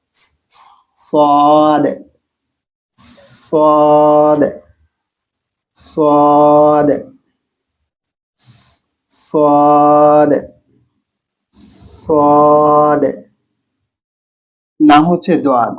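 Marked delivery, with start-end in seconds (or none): 0.1-0.7 স- as a bare unvoiced hiss; voiceless s. Shown as a demonstration. Teacher isolating the s sound for students. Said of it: "উচ্চারণটা হবে স". 1.1-13.0 সদ with a thick, heavy s from the throat. Shown as a demonstration. Teacher drilling the heavy letter সোয়াদ. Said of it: "সোয়াদের উচ্চারণ মোটা".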